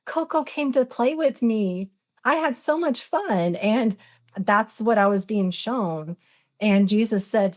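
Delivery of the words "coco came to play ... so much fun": put-on high-pitched excited child quote; excited kid voice, lifted high; super excited. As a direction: imitate a thrilled little kid reporting back. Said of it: "you would always come downstairs super excited".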